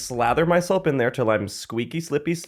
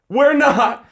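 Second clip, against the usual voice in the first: sassy retort; 10 semitones higher, 5 dB louder than usual. Retold or imitated. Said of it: "And I'm like".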